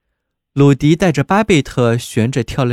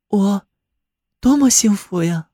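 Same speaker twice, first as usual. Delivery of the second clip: put-on higher-pitched, happy girl's voice; happy young bride; 5 semitones higher than usual. A narrator's reading. Babette marvels at her happiness to Rudy, higher-pitched and joyful. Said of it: "巴贝特说道".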